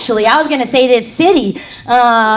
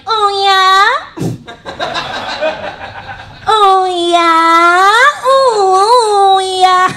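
put-on a higher, taunting kid's oh yeah; cocky kid; 7 semitones higher, much slower than usual. Nephew taunting the nun after a knuckle rap, mock cocky. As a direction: dare the nun like a bratty nephew. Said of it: "He'd be like".

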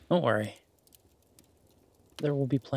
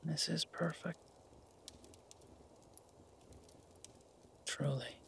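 very soft, near-whispered contentment; hushed, intimate and content, with long pauses; tender murmur; 4 semitones higher, 13 dB quieter than usual. Acted. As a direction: speak softly to someone resting in your arms. Cuddling contentedly by the fire.

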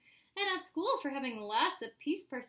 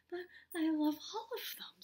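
mild, higher-pitched protest; crying out; 4 semitones higher than usual. A narrator's reading. Spencer protests losing his toys, mildly tearful. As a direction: plead like an upset child. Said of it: "Spencer cried".

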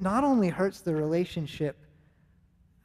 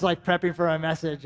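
ordinary talk

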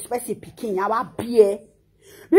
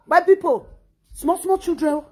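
normal talking voice

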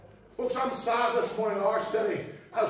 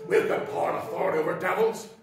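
lower, fast, sneering boast; mocking boast; 6 semitones lower, faster than usual. Mocking Pentecostal preachers who brag about their power, quick sneer. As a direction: parody the braggart preacher, quick and dismissive. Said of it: "sick, sore, and tired of hearing Pentecostal preaching like that".

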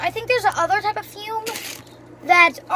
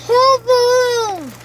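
excited; 7 semitones higher, 6 dB louder, slower than usual. Real, not acted. Inhaling the whole balloon.

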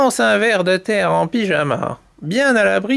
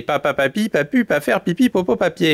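quick tongue-twister, words run together; faster recital; 2 semitones lower than usual. Shown as a demonstration. Storyteller tells chicks to speed up, faster second take. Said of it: "récitez de plus en plus vite".

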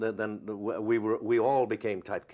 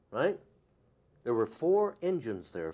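normal talking voice

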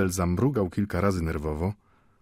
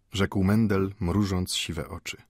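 usual speaking voice

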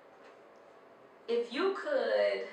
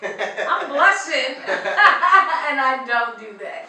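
laughing through the words; loud, slow, giggling delight; 5 semitones higher, 11 dB louder, much slower than usual. Real, not acted. Host laughing after the song.

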